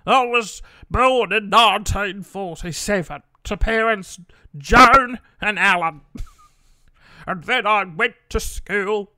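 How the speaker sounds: slow, loud put-on old man telling his life; old man voice, rambling. Imitating dad launching into his life story, slow old man voice. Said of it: "How do you do an old man?".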